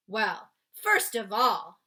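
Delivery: big, loud friendly giant explaining. Acted. Giant explaining to Sophie why he took her. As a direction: boom it out like a kindly giant. BFG voiced in read-aloud.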